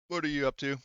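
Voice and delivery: ordinary talk, nothing marked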